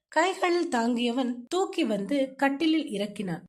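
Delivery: ordinary delivery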